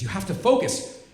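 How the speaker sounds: mother insists firmly; firmer urgent repeat. Retold or imitated. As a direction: press the point harder.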